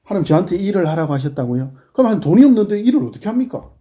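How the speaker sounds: complaining to God. Retold or imitated. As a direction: argue back at God in a huff. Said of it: "시비 걸 게 아니라".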